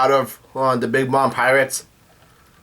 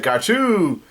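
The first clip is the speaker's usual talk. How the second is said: higher, singy mock call; playful gotcha; 6 semitones higher than usual. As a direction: imitate a triumphant catch, pitched up. Carrot catching Diesel, acted.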